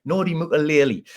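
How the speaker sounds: Nordi Mukalele ploughed through in a Sunderland accent. Shown as a demonstration. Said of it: "say it with confidence and plough through it".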